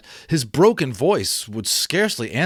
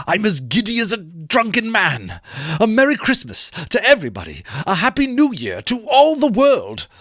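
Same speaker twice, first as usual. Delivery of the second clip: higher, rising elation; merry old man greeting the world; giddy Scrooge; 7 semitones higher, 3 dB louder than usual. Acted. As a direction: build from light joy to a happy shout. Scrooge voiced in story reading.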